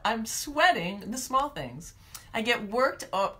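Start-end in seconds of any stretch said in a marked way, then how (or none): none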